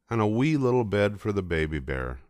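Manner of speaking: ordinary delivery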